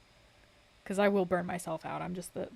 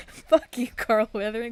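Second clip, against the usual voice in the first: playful yell; 3 semitones higher, 10 dB louder than usual. Real, not acted. Mock offended outburst at chatter.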